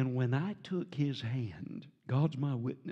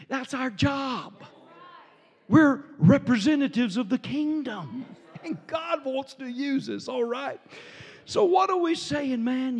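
full-force preaching; 11 semitones higher, 7 dB louder than usual. Acted. Preacher urging the church to serve, full force. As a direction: drive it home to the congregation.

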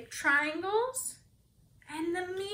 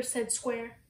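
ordinary delivery, nothing marked

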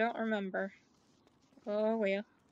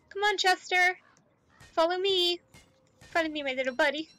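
calling a pet; 5 semitones higher, 5 dB louder, faster than usual. Real, not acted.

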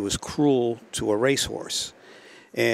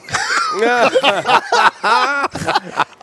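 high, loud burst of laughter; big laugh; 8 dB louder than usual. Real, not acted. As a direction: crack up at your own punchline. Teller cracks up at own story.

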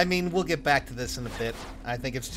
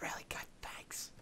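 ASMR whisper; 14 dB quieter, slower than usual. Pretend. Continuing the ASMR whisper bit.

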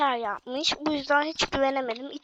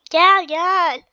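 louder, drawn-out call to come; 3 semitones higher, 6 dB louder than usual. Real, not acted. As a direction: call your playmate over loudly. Calling the follower over in game. (seslenerek çağırma)

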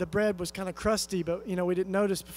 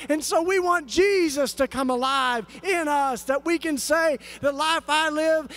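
higher, louder, rising fervent preaching; full-force preaching; 7 semitones higher, 5 dB louder than usual. Acted. Pastor preaching to his church in full-force fervor. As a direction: lift the congregation with pleading fire.

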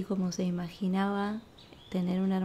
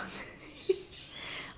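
soft laugh; 7 dB quieter than usual. Real, not acted. Laughs recalling daughter's excuse.

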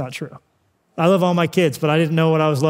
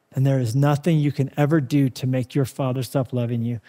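ordinary delivery

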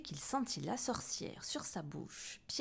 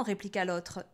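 usual speaking voice

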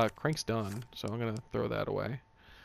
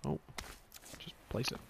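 whispering; 12 dB quieter than usual. Real, not acted. Whispered to self.

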